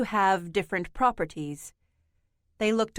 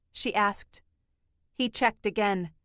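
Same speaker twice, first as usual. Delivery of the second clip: ordinary delivery, nothing marked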